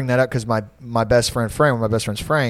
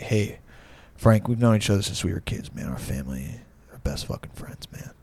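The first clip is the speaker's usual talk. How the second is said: softer, low, trailing into a near whisper; drunk heartfelt appeal; 5 semitones lower, 9 dB quieter than usual. Retold or imitated. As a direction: imitate a drunk friend getting sentimental. Drunk friend pleading in retold story.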